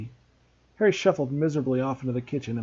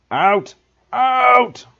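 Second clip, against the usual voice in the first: much higher, loud barked shouts; furious shout; 8 semitones higher, 11 dB louder than usual. A narrator's reading. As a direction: roar it, rising to the second. Vernon throws Harry out.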